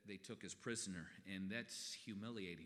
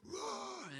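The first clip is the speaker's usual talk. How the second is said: high, loud football roar; pumped-up team roar; 6 dB louder than usual. Retold or imitated. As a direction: bellow it like a fired-up player. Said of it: "he'd go".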